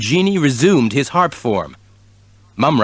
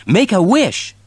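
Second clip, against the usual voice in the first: kitten voice; 8 semitones higher than usual. A narrator's reading. Kitten voice in story.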